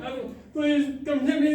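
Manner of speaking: high, slow mock-crying whine; imitated bawling plea. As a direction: replay the sobbing kid begging to go home. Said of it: "bawling my eyes out".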